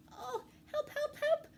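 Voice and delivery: high, rising cries for help; panicked cry. A narrator's reading. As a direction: call out in panic. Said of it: "cried Mouse".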